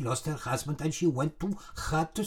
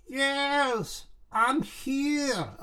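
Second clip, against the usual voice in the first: higher, distant spirit voice; ghostly voice from nowhere; 7 semitones higher, much slower than usual. Retold or imitated. Ghost answering the widow at the seance, eerie voice. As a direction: call out faintly like a ghost. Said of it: "he spoke like that".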